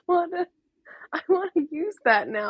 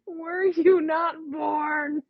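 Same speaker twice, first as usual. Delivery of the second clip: slow savoring repeat; much slower than usual. Real, not acted. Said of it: "That was a beautiful one".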